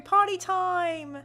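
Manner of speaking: high, slow, loud whoop of party time; party call. Acted. Host rallying the crew in a loud, drawn-out cheer.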